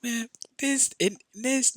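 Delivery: high, slow drawn-out mock cussing; imitating himself yelling at cops, slow and high. He cusses out cops who won't drive him home, mock angry.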